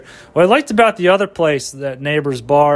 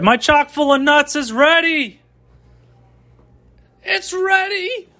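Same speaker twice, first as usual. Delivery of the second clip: high-pitched call through the house; calling out coffee ready; 11 semitones higher, slower than usual. Real, not acted. Vlogger calling out to the house that his coffee is ready, high-pitched yell. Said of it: "I'm already yelling".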